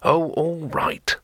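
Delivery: irritable. Acted. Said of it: "said Rincewind irritably".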